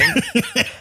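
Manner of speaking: a short high giggle. Acted. Narrator voicing Hermione's startled giggle. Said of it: "slightly laughing".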